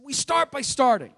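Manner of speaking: put-on half-hearted New York accent, punched up; attempted New York accent. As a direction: imitate a New Yorker's saying, lightly. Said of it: "said it in his New York accent".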